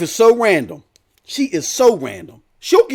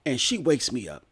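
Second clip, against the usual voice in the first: usual speaking voice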